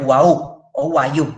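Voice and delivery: "uau o uayu" said slowly, each vowel rounded and clear; careful pronunciation. Shown as a demonstration. Teacher modelling the plural of one, slow and deliberate. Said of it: "se va a pronunciar como una U".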